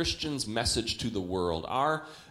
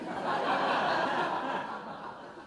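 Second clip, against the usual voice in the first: airy high giggle; breathy high laugh; 11 semitones higher, 3 dB quieter than usual. Real, not acted. Preacher to congregation, high airy laugh. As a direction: let a soft unvoiced laugh out. Laughing at his own joke.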